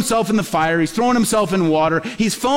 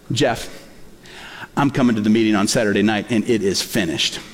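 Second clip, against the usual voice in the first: much lower, calm, assured divine voice; voice of God; 9 semitones lower than usual. Retold or imitated. God assuring the pastor in his spirit, deep calm certainty. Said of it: "I heard him say this".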